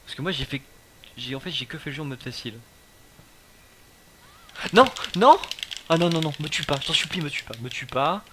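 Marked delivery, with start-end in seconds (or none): 4.7-8.2 panicked pleading. Real, not acted. Nemesis attacks, begging for life.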